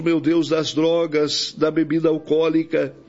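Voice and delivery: ordinary delivery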